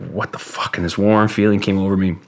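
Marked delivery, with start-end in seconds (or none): 0.1-0.8 a breathless whispered what the fuck; whispered disbelief. Retold or imitated. Stunned by the brother's vision, whispered disbelief. Bewildered inner thought.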